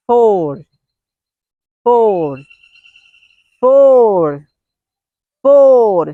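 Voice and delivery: "four" said loud and drawn out; emphatic drill. Shown as a demonstration. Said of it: "Next one is".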